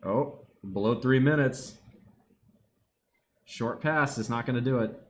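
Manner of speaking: normal talking voice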